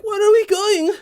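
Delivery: put-on high innocent child question; kid voice. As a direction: ask like a small kid being carried. Kids asking the Viking.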